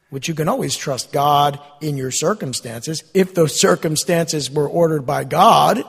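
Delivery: ordinary delivery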